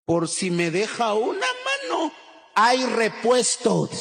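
respuesta entre risas y gritos; riendo. Retold or imitated. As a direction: lo suelta muerto de risa. El amigo responde riendo. (el muchacho explica sus dos novias entre risas)

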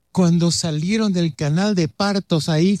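ordinary delivery

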